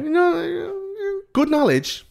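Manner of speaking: very high sing-song voice; mock sarcastic praise. Uncle answering a know-it-all nephew, hammed-up high sarcasm. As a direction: patronise the kid in a silly squeak.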